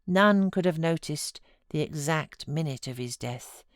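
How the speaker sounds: usual speaking voice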